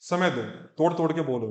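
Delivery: ordinary talk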